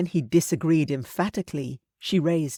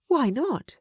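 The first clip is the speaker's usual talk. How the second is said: sharp, higher pointed question; pointedly; 10 semitones higher than usual. A narrator's reading. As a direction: challenge him with it. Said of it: "she asked pointedly".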